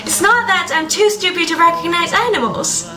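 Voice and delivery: ordinary talk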